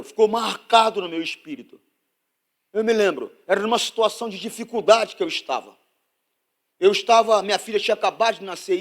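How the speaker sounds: loud, high, driving sermon voice; fervent pulpit preaching; preaching at full force. Acted. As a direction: preach it out to the whole church.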